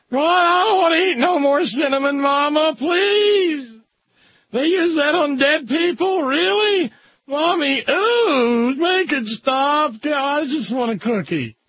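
put-on very high-pitched whiny kid on the verge of tears; scared little child. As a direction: play a frightened small child begging mommy. Child pleading to stop.

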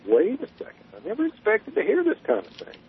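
mock surprise, pitched high and kept soft; taken aback. Man retelling his shock at his date's remark, taken aback.

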